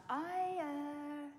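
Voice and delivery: nervous high quote. Retold or imitated. Nervous retold question.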